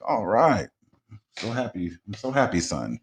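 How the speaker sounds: imitating his mother with a mild Southern lilt; mom's Southern accent. Son mimics his happy mom in a light Southern accent. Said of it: "you always give her that old Southern accent".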